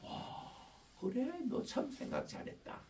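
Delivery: lightly voiced kind teacher; tender praise. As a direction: gently praise the child. Teacher praising child softly.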